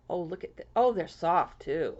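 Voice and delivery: ordinary talk, nothing marked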